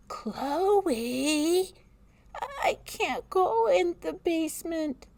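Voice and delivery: put-on high, frightened little girl voice; scared child calling. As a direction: call out like a scared kid. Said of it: "Chloe called".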